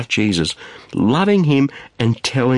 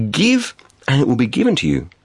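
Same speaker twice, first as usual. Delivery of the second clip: deliberate, with a pause after the first word; measured proclamation; 2 semitones higher than usual. Acted. Preacher quoting Jesus's saying to listeners, measured proclamation. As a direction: let the first word stand alone. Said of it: "he said this".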